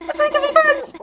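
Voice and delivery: very high, sing-song plea; high pleading cry for friendship; squeaky pleading. Pretend. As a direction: chirp it like a hopeful cartoon kid. Pleading repeatedly to a character.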